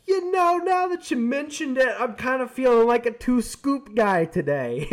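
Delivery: very high, loud, mock-innocent voice; sly customer. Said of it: "and he goes".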